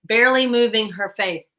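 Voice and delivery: normal talking voice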